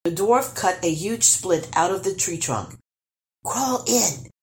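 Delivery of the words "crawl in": higher-pitched urgent prompt; urging. A narrator's reading. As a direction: hurry him into the hiding place. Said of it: "he urged".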